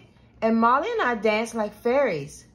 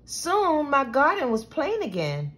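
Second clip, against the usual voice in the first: usual speaking voice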